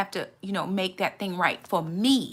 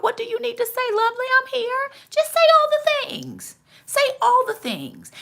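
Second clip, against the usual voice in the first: tender coaxing; 14 semitones higher than usual. Retold or imitated. Jesus gently coaxing a crying woman to speak her mind. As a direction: coax like a comforting friend. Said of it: "just let them talk".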